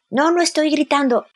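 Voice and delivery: put-on defensive teen son. Hijo replica a su madre. (hijo adolescente a la defensiva, con voz mucho más aguda)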